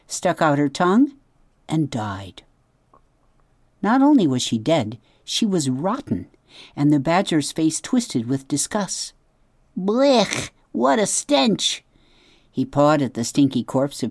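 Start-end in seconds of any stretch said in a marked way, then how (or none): 1.7-2.4 whispered punchline. A narrator's reading. Comic death in narration. 9.8-11.8 high, slow cry of disgust from the badger.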